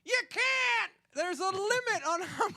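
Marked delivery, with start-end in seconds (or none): none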